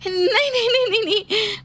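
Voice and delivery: high, rapid frightened noes; panicked refusal. Acted. Daughter-in-law refuses to follow the ghost to death, panicking. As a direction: back away in panic.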